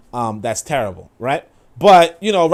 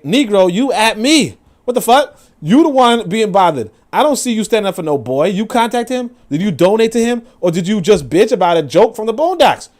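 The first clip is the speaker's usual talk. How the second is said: higher, louder angry comeback; heated retort; 7 semitones higher, 4 dB louder than usual. Retold or imitated. Firing back at a Twitter critic, heated and louder. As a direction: tear into him. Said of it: "And I'm like".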